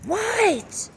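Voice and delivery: very high, sudden cartoon "What?"; mother finally answering, very high; Stewie's snap. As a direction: shriek it in exasperation. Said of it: "Thank you, Stewie".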